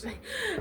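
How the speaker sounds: short high laugh. Real, not acted. Laughs at own sarcasm.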